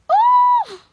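short, loud, very high shriek; piercing overjoyed squeal; excited scream. Real, not acted. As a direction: shriek with delight. Overcome with excitement at plush.

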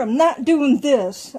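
emphatic. Shown as a demonstration. Contrasts rough brushing with gentle brushing.

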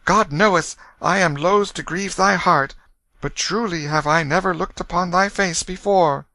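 put-on high boy's voice, a touch of regret; reluctant young prince. A narrator's reading. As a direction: admit it reluctantly, lightly voicing a prince. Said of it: "reluctantly said".

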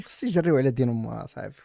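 ordinary talk, nothing marked

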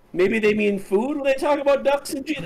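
high-pitched mock-serious reading; mock extreme confidence. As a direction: imitate an overconfident announcer, pitched high. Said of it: "read it with just extreme confidence".

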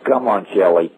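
usual speaking voice

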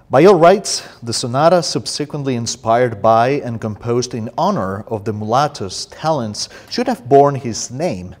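emphatic, declaimed introduction; formal reading aloud. Acted. Poet reads book introduction to audience, emphatic formal reading.

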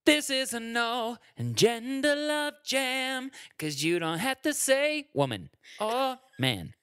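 slow, high showy song intro; mock audition intro. Pretending to audition for the show, hammed up. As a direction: announce the song like a contestant. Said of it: "I should audition".

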